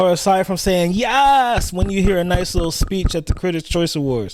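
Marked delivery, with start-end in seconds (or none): 0.9-1.7 drawn-out, high-pitched mock yes; mock gushing cheer. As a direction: imitate an easily thrilled fan. Mimicking fans cheering an awards speech.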